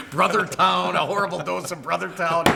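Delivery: usual speaking voice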